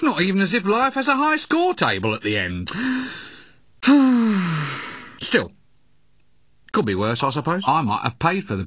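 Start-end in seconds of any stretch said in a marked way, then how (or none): 3.8-5.0 heavy sigh. Acted. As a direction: sigh of a tired apprentice. Weary sigh before complaint.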